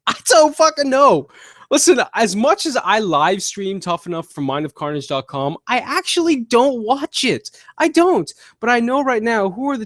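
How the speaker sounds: venting at a fan question about Tough Enough; exasperated outburst. Real, not acted. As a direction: throw up your hands and vent.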